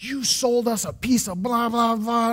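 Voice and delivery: hammed-up furious old man on the phone; angry grandfather. Grandfather yelling at the seller over the phone, mock angry rant. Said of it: "He starts yelling at me".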